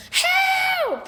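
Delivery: high, loud panicked scream. A narrator's reading. Sheila scared of the dog, screaming high and loud. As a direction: shriek for help in fright. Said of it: "she screamed".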